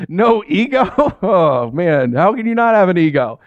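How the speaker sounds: normal talking voice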